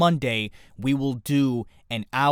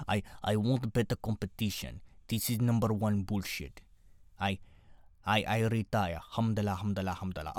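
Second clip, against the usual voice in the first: bad Russian accent, quiet and low; hammy Russian accent, softening to a mumble at the end; Khabib; 4 semitones lower, 6 dB quieter than usual. Fighter announcing retirement, imitated in poor Russian accent. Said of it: "my Russian accent is terrible".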